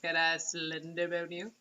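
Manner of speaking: low, slow, soft creepy voice; creepy monster. Pretend. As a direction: leer like the monster.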